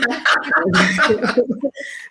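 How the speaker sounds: loud, high burst of laughter; real laugh. Real, not acted. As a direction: break into a big high laugh. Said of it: "I'm laughing because".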